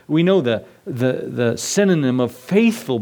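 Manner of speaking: normal talking voice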